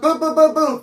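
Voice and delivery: punch sounds. Retold or imitated. Imitating punches again.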